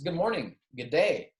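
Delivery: ordinary delivery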